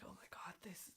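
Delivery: whispering. Retold or imitated. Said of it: "it looks like".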